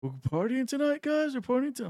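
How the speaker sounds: put-on high, sing-song drunken hype; drunk party guy. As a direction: whoop it up like a drunk stranger. Imitating drunk guy at bar.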